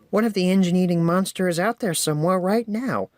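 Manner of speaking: high worried little engine voice; Percy, worried. A narrator's reading. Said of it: "Percy asked".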